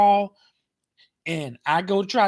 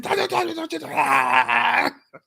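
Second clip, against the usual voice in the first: mock hurt wail; 6 semitones higher than usual. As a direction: stretch it out like a faked injury. Replaying the pretend victim.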